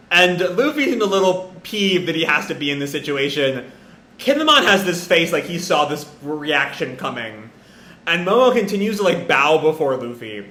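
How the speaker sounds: usual speaking voice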